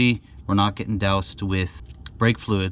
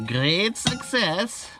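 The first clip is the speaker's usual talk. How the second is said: Borat impression; 10 semitones higher, much slower than usual. Triumphant catchphrase after fix.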